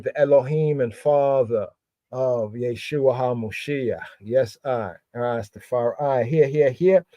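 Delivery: normal talking voice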